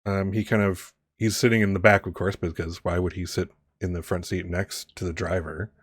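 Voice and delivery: ordinary talk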